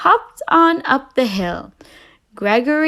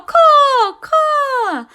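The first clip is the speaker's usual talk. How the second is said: loud, high rude caw; a crow cawing rudely at the groundhog; 14 semitones higher, 5 dB louder than usual. Acted. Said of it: "hoarse and rather rude caw".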